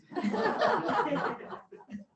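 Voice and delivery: bright burst of laughter; high delighted laugh. Real, not acted. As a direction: laugh at yourself. Admits baby talk to cats.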